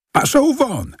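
high yelled curse at the cat; shout. A narrator's reading. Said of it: "krzyczał". (wysoki okrzyk na kota)

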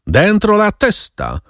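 high, slow menacing order; a sinister rider repeating his order to the captive; ominous voice. A narrator's reading. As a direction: lay on the threat, drawing out Dentro la testa. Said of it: "ten sam złowróżbny głos".

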